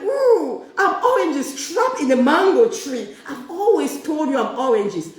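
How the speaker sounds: high, loud gush; a gleeful mock declaration of who she really is. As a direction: camp it up with delight. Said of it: "I'm like".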